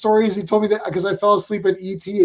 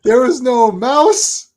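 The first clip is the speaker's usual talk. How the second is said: retold indignant complaint; 6 semitones higher than usual. Acted grandfather's film complaint.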